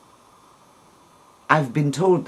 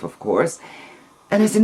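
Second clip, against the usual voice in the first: ordinary delivery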